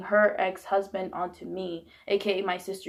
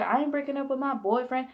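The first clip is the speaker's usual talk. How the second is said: high-pitched protest at being told to split; retold defiant refusal; 5 semitones higher than usual. Daughter refusing mother's threat in rehab, defiant. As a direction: quote yourself digging in, pitched up.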